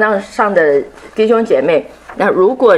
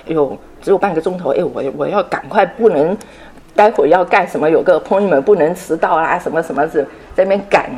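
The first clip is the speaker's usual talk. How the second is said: rushed, fast muttering of someone watching the clock; mock hurried reader. Teacher imitating a student rushing through Bible reading, mock hurried. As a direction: rattle it off like you're late.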